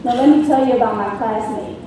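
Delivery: usual speaking voice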